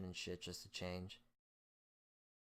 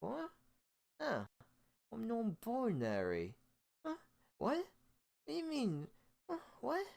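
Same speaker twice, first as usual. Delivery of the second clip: very high, loud, squeaky repeated huhs; hammed-up baffled squeak, over the top; mock confusion; 4 dB louder than usual. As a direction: squeal the questions like you are lost. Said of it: "And I go".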